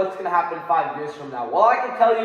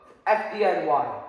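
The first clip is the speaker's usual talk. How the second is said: ordinary talk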